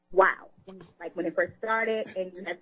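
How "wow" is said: wow. Real, not acted. Amazed at covid ward memory.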